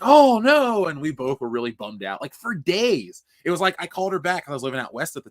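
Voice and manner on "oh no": much higher, louder mock motherly dismay; hammed-up mother's oh no; mom's dismay. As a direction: cry out as his upset mom. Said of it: "she's like".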